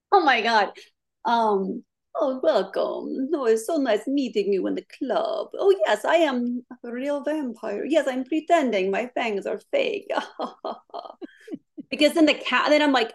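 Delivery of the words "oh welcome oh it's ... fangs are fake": put-on hammy vampire with a silly shifting accent; Nyxferatu. Demonstrating her vampire character to the host, ridiculous accent. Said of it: "ridiculous accent that changes during the clip".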